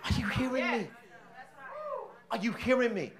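preacher gently asks the congregation, soft; soft question. Shown as a demonstration. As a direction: ask it quietly, almost to yourself. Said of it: "Y'all ain't saying that".

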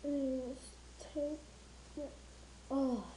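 muffled behind respirator; speaking while removing mask; through a gas mask. Real, not acted. Seen 4: Respirator mask still over mouth while removing it; pushed up by +4.1.

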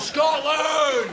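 very high, strained yell; defiant battle cry. Acted. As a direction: howl it with patriotic fury. Patriotic cry at the lift.

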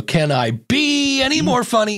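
mock the sitcom friend's ironic question; Chandler Bing impression. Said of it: "make everybody sound like Chandler Bing".

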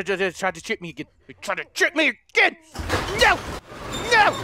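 ordinary talk

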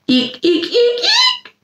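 tiny high squeak like a squeaky shoe; rubbery high squeaky toy. Pretend. Squeaky shoe noise again.

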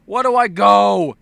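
high, drawn-out exasperated wail; confused player yelling. Acted. Gamer lost in a badly explained game, exasperated yell. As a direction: cry out as a lost, fed-up gamer. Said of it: "you're just like".

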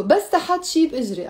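quick run-through of the phrase; fast. Shown as a demonstration. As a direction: rattle it off as one linked phrase. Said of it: "I said it fast".

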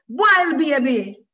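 loud, high mocking yell; jeering call. A narrator's reading. Friend calling out to mock Moshe, a loud high jeer. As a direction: sing it out to tease. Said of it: "his friend snickering called out".

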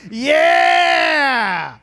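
loud, slow hype yell; rowdy shout. Acted. Comic boasting to the crowd, rowdy shouting. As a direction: whip the room up.

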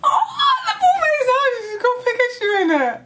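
very high squeal tumbling into quick words; excited squeal. Real, not acted. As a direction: shriek with delight, then rush it out. Thrilled squeal at the reveal.

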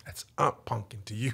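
low, soft, firm correction from an offended aunt; a hint of a haughty elder; stern aunt. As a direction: put the kid in his place quietly.

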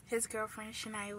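usual speaking voice

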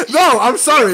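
loud, high laughing apology; cracking up while protesting; real laughing. Real, not acted. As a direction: apologise through a fit of laughter. Apologising while laughing at own joke.

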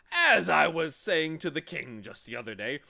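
loud, high, hectoring voice; blustering humbug. A narrator's reading. Humbug demanding introduction to the boy, loud bluster. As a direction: scold them grandly at full volume.

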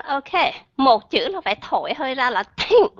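normal talking voice

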